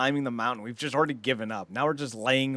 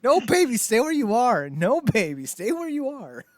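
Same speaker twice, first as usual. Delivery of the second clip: put-on high, swooping plea to a baby; mock pleading parent; 9 semitones higher than usual. Parent telling crawling baby to stay, mock pleading. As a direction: coo a comic warning in a raised voice.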